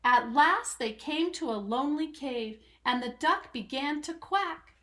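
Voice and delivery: ordinary delivery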